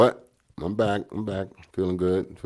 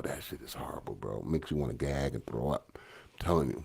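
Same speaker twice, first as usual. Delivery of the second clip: normal talking voice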